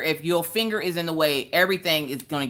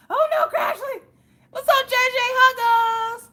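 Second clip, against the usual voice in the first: much higher and louder, squealing; squealed falsetto; 4 dB louder, slower than usual. Real, not acted. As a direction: shriek a laughing hello to viewers. Laughing falsetto shout to viewers.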